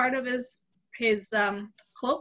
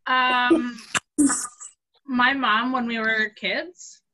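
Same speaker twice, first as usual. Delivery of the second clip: ordinary delivery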